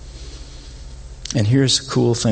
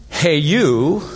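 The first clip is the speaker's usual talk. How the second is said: a loose, offhand call to a stranger; casual hey you; 4 semitones higher than usual. Shown as a demonstration. Preacher showing how strangers get hailed, offhand. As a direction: toss it out to nobody in particular. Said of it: "Instead of saying".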